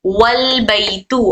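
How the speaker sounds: slowly, joining वल straight into बैतू; slow demo. Shown as a demonstration. Teacher showing students the joined reading, slow and careful. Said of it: "मिलाकर पढ़ने की सूरत में".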